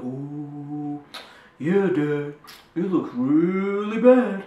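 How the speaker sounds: gloating brother. Retold or imitated. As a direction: rub it in with fake concern. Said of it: "just looking".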